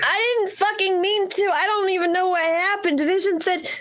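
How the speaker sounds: high-pitched, agitated self-defense; panicked protest. Acted. Confused recruit defending themself to a teammate, panicked. Character panics at realizing the betrayal.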